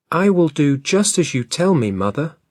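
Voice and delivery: lightly voiced obedient girl; little girl's voice. A narrator's reading.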